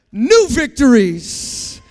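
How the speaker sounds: very loud, drawn-out preacher shout; booming proclamation. Real, not acted. Preacher proclaiming new victories to congregation, booming proclamation. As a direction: belt out NEW VICTORIES slowly. Same words then proclaimed aloud.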